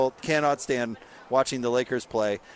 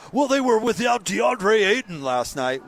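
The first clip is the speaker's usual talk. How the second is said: higher, drawn-out mocking defense; mock excuse-making; 6 semitones higher, 3 dB louder than usual. Host parodying the Lakers' counterargument, mock excuse-making. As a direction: imitate a fan making a lame excuse.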